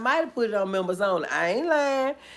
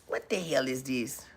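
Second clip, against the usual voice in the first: normal talking voice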